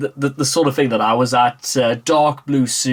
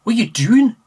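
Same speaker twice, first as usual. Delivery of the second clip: mock Scottish retort; 9 semitones higher, 4 dB louder than usual. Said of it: "Scottish phrase".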